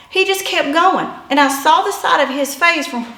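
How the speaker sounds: ordinary talk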